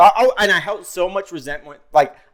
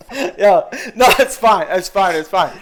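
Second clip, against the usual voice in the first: higher, louder, laughing through the words; 3 semitones higher, 4 dB louder than usual. Real, not acted. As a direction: laugh off the hurt, not quite fine. Laughing off a hurtful remark.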